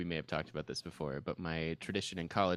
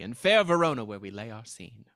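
mock Shakespearean stage accent, rolled and grand; old Shakespearean accent; 8 dB louder than usual. Friend reciting Romeo and Juliet to friends, mock old stage accent. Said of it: "You're just doing the old accent".

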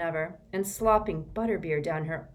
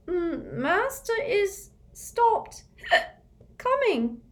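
high, slow, slurred elf voice with a laugh; a tipsy little elf giggling; drunk elf; 4 dB louder, much slower than usual. Acted. Drunk Winky answering Harry, slurred squeaky laugh.

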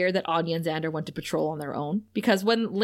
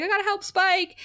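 eager robot; 10 semitones higher than usual. Retold or imitated. S0 voices the Buffybot.

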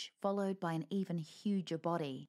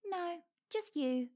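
high, slow cheeky put-down; cheeky ferret voice; 11 semitones higher, slower than usual. Acted. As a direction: toss the insult back lightly. Ferret insulting the wolf.

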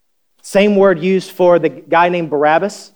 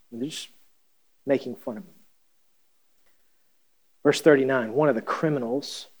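ordinary delivery

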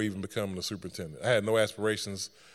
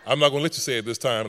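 playful defiance; 3 semitones higher, 7 dB louder, faster than usual. Retold or imitated. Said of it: "And I was like".

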